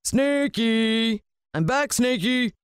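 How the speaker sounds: high, drawn-out singsong call; villain calling his pet. A narrator's reading. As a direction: sing out a greeting to a pet, high and stretched. Villain calls his pet snake.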